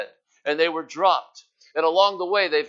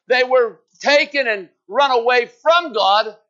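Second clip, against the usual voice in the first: raised, driving preacher's cry, pressing each line higher; preaching at full force; 8 semitones higher, 6 dB louder than usual. Acted. Preacher rising over the congregation, full-force preaching. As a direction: hammer it home to the church like a revival preacher.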